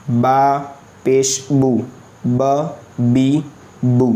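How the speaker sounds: slow, halting spelling, pausing after each part; step by step spelling. Shown as a demonstration. Teacher drills pupils in spelling letters, slow and halting. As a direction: spell it out stop by stop. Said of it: "रुक रुक कर, step by step".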